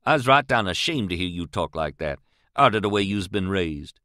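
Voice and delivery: usual speaking voice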